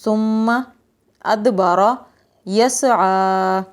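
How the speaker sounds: ثُمَّ, أَدْبَرَ, يَسْعَىٰ split apart with pauses; word by word. Shown as a demonstration. Teacher splitting three words for children, one by one. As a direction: say each word separately. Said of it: "یہ تین لفظ ہیں".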